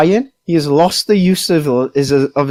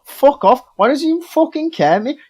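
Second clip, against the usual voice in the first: higher-pitched mock outrage; retold angry rant; 7 semitones higher, faster than usual. Player retelling a row over paralysis, mock indignant. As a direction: imitate an indignant player ranting.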